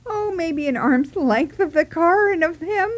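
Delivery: normal talking voice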